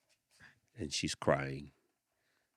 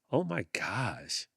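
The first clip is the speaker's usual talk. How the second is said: mocking kid; 7 semitones higher, slower than usual. A narrator's reading. Kids mock stuck girl.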